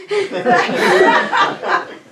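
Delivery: a high, breathy burst of laughter; surprised laugh. Real, not acted. Comedian laughs at the surprise reveal, high burst of laughter. Reacting to grandpa's pilot license.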